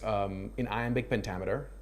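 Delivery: ordinary delivery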